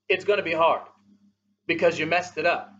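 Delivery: usual speaking voice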